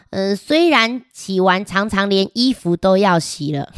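plain reading voice

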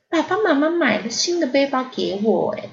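sulky pouting squirrel, lips pushed out. Acted. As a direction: whine it with a pout. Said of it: "嘟着嘴， 不高兴地说".

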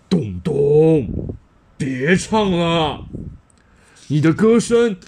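a hint of a big booming policeman; voice like a big dinosaur. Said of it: "声音好像大恐龙".

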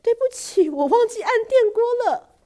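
high, loud alarmed cry; mother crying out in alarm; sudden shout. Acted. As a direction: yell it as a sudden realization. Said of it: "突然妈妈大叫".

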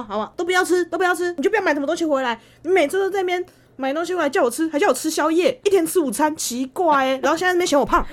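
high, loud exasperated rant at parents; hammed-up grumbling at parents; mock rant. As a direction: complain at them, fed up. Ranting at parents about food.

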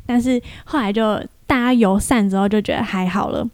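normal talking voice

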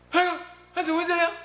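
put-on high, fast startled kid's cry; a little boy spooked by the ball; child's voice, high and quick with alarm.

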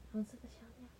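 soft whisper of the phrase. Acted. Reading to a little child in a hushed whisper. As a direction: breathe the words to the child.

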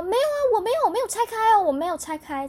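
a customer caught out, protesting loudly; mock loud denial. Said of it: "他讲超大声". (高声大喊否认)

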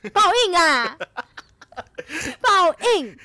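loud, high gloating with a laugh. Real, not acted. Gloating about her ex's bad luck, loud and laughing. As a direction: crow over his bad luck.

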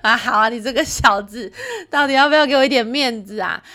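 loud, laughing playful scolding; laughing mock scold. Real, not acted. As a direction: tease the little rascal while still laughing. Mock scolding her son. (笑着假装责备儿子)

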